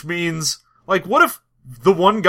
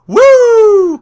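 long, high, loud woo; party whoop; 10 dB louder than usual. Acted. Imitating the arm-raised dwarf, loud party whoop. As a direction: let out a drawn-out spring-break whoop. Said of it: "one hundred percent arm raised, just going".

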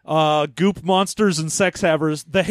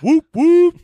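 high, loud two-note horn hoot; woot horn imitation; 10 semitones higher, 8 dB louder than usual. Showing the co-host a woot horn, loud high hoots. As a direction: toot it out like a horn. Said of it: "that's all the woot horn does".

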